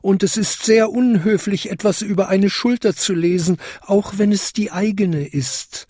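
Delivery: high little-bell voice; tinkling little bell. A narrator's reading. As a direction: ring it out like a tiny bell. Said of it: "bimmelte die kleinste Glocke". (hohe, bimmelnde kleine Glocke)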